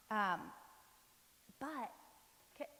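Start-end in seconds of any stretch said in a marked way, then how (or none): none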